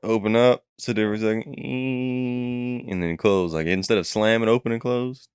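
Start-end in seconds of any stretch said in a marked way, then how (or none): 1.6-2.9 a high, quiet mock creak of a door opening slowly; door creak imitation. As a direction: hum the door's slow creak, high and soft.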